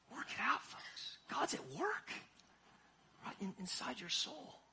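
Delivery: sudden hushed whisper to the congregation. Acted. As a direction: drop to a whisper, intimate. Sudden hushed urging in sermon.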